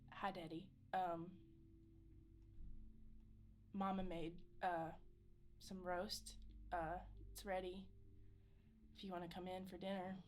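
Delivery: halting, softened greeting to a father; hesitant. Acted. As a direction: feel your way through it with long pauses. Awkward first words to father.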